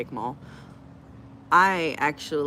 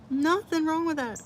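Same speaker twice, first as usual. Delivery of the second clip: sing-song; 12 semitones higher than usual. Real, not acted. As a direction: lilt it up playfully.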